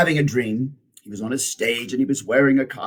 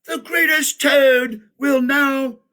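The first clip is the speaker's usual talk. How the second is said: high, loud, slow proclamation; strange announcer voice; 12 semitones higher, 4 dB louder than usual. A narrator's reading. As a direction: boom it like a ringmaster. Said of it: "Then the voice said".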